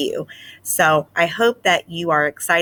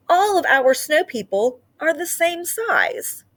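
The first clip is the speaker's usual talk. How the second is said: higher, surprised realising voice; puzzled mouse; 10 semitones higher than usual. Acted. Desmond comparing the snow people in town, puzzled. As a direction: notice the problem aloud. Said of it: "he said".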